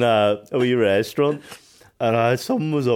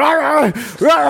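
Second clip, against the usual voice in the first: loud, high mock dog barks; frantic imitated "rah" barks; imitated barking; 12 semitones higher, 5 dB louder, slower than usual. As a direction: bark like a dog going mad at the window. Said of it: "Beamish is out the window going".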